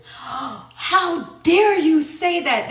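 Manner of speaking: gasp, then draw out the scolding; imitating a scandalized judge; indignant judge, retold. Quotes the outraged judge scolding her.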